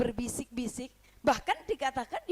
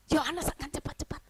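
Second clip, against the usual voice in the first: usual speaking voice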